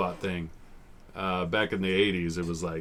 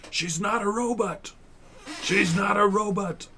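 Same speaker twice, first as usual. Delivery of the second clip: high desperate plea; 13 semitones higher than usual. Retold or imitated.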